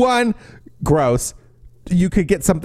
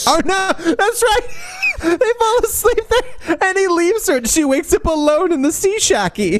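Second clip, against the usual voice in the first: high giggly telling; high laughing voice. Real, not acted. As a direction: talk through giggles.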